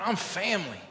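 normal talking voice

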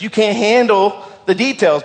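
lightly put-on accent with attitude; Jack Nicklaus. Preacher voicing God refusing to give details, mock movie-star impression. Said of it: "pulls out his best Jack Nicklaus".

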